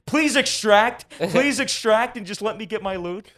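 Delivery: high, drawn-out mock plea; acted pleading. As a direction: beg loudly, stretching each request. Acts out plea he couldn't make.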